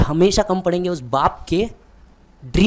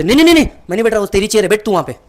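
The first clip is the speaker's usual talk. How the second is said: higher, louder flustered refusal; a father waving off the big chair, loudly; father protesting to his son, higher and louder; dad refusing; 4 semitones higher, 6 dB louder, faster than usual. Retold or imitated. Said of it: "पापा बोलते".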